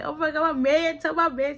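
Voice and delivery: high, gushing girlfriend imitation; giddy and happy. Imitating her gushing over her man, giddy. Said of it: "she was so giddy and happy".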